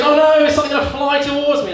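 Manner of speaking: the man in the bunker fears a flying duck, high fast fright; frightened bunker mate. Retold or imitated. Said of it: "he goes".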